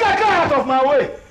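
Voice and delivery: high, forceful order; angry shout. Acted. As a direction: shout them out of the way. Angry quarrel, ordering partner aside.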